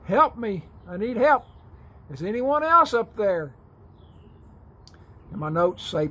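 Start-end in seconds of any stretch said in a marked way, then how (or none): 0.1-3.5 high panicked shouts for help; yelling again. Acted. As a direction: call out louder, desperate. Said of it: "George yells out again".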